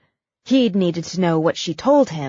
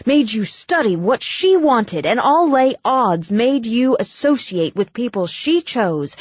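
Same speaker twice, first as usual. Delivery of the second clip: louder, heated tirade rising high; building rant; 6 semitones higher, 4 dB louder than usual. Acted. Brooke railing at Abigail's cold mother, heated and loud. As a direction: tear into the mother, voice climbing.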